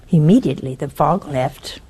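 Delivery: normal talking voice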